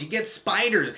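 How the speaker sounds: ordinary talk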